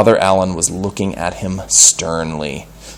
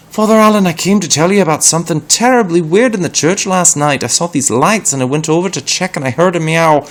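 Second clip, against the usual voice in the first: higher, louder, fast breathless telling; excited boy; 10 semitones higher, 6 dB louder, faster than usual. Acted. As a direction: blurt it out in a rush. Peter rushes excited report.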